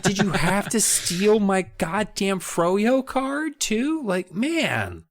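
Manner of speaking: high-pitched, drawn-out comic outrage; mock exasperation. Subject griping at pilfering royalty, mock exasperated. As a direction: complain in an aggrieved high voice.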